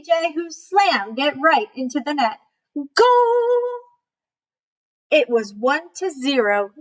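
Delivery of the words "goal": excited goal call. A narrator's reading. Narrator cheering the winning goal.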